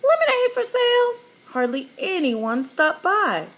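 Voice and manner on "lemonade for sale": high, fast squawking parrot voice. A narrator's reading. Parrot Petey squawking to passersby, high and shrill. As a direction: squawk it out like a parrot hawking lemonade. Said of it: "Petey squawked".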